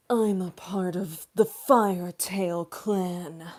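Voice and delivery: slow, loud furry character voice. Acted. Streamer mimicking someone's half-wolf character, slow furry voice. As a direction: announce it slowly and loudly as a furry. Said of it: "Can you do a furry voice?".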